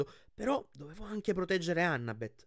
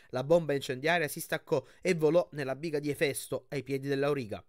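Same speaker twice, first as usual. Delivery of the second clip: ordinary delivery, nothing marked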